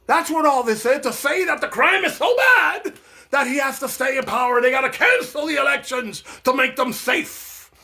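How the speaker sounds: rising to an angry shout, pitched well up; heated rant. Real, not acted. Ranting to viewers about a cancelled election, shouting. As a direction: build the outrage into a near yell.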